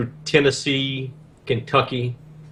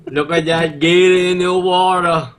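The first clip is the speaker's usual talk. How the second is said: slow, loud Cajun Swamp People drawl; a slow, loud Cajun accent like the TV swampers; 4 semitones higher, 4 dB louder, much slower than usual. Retold or imitated. Mocking the Swamp People filmed in his town, in a slow, loud Cajun accent. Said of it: "the southern French thing going on".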